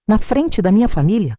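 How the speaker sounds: plain reading voice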